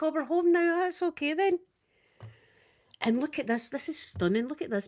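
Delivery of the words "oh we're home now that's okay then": high, playful voice for relieved bowels; high mock voice of a body part; talking bowels. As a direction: pipe up as contented bowels. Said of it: "The bowels like".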